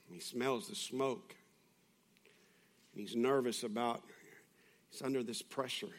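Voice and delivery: soft, low, solemn sermon voice; hushed preaching. Real, not acted. Preacher reflecting on Peter's failure, hushed and solemn. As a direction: drop to a grave hush with long pauses.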